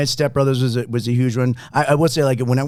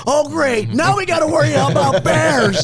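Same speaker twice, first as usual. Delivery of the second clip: high mock voice; 13 semitones higher, 3 dB louder, slower than usual. Quoting movie line.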